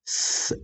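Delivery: soft voiceless स hiss; isolated s sound. Shown as a demonstration. Teacher demonstrating the sound, bare soft स hiss. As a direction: only air through the teeth for स. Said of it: "ऐसा करके हम आवाज निकालते हैं".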